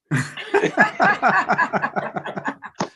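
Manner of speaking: long high laugh. Real, not acted. Laughing at own story.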